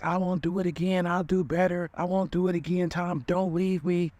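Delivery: imitating a crying girlfriend, high-pitched begging; mock tearful pleading. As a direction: plead in a high, put-on bawling voice. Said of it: "crying, bawling, like".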